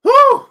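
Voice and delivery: loud, high "whew" of excitement; fired-up whoop. Real, not acted. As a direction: let out a big fired-up whoop. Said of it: "he sound like he fired up".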